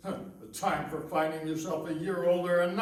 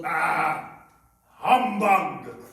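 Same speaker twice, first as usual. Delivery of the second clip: gruff dismissal; 3 semitones lower, 5 dB louder, much slower than usual. Acted. Scrooge dismisses Christmas.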